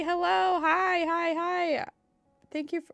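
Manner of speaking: normal talking voice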